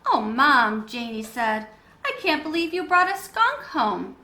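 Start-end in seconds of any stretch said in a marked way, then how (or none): 0.1-0.9 loud, drawn-out protest; exasperated. A narrator's reading. Janie scolding Mom for the skunk, loud protest. 2.0-4.2 high, louder incredulous voice; disbelief. A narrator's reading. Janie to Mom about the skunk, high and incredulous. Janie protests mom's surprise.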